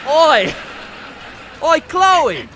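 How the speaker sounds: loud, high Aussie holler; Australian accent. Shown as a demonstration. Host calling his Australian guest, shouted mock Australian. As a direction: call out like a caricature Australian. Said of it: "Do your best Australian accent".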